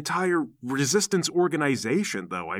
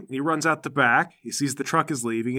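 ordinary delivery

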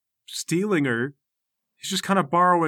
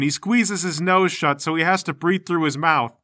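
ordinary delivery

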